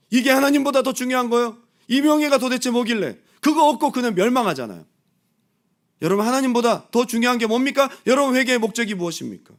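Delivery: loud, high preaching. Acted. Pastor pressing his congregation, loud and high.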